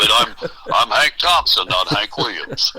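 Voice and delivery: mock deep stage voice. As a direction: sink into a deep-voiced singer's correction. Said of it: "that real deep voice, you know".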